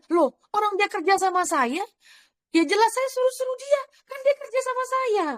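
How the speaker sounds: very high-pitched mock retort; indignant boss. Boss retorts in a retold quarrel. (bos membantah dengan nada tinggi)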